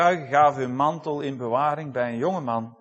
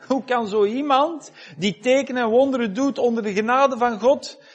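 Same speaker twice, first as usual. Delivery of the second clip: higher and louder, pleading with God; impassioned preaching; 7 semitones higher, 6 dB louder than usual. Acted. Preacher asking God why Stefanus died, in impassioned pleading. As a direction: cry out the question of why to heaven.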